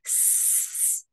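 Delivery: S as a long voiceless hiss; drawn-out s like a snake. Shown as a demonstration. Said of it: "Letter S sounds like a snake".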